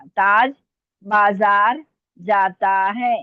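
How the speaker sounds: slow, firmly spaced words; slow dictation. Real, not acted. Teacher dictating the last sentence to students, slow dictation. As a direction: dictate it word by word. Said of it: "मैं आराम आराम से बोल रही हूँ".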